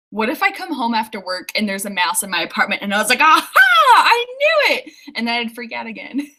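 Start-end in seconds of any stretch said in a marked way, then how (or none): 3.2-4.9 high-pitched pretend gotcha cry; mock triumphant alarm. Imagining a mouse found after work, mock alarmed triumph. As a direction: act out an exclaimed I-told-you-so.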